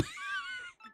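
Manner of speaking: high-pitched burst of laughter. Real, not acted. Laughs after the deep voice.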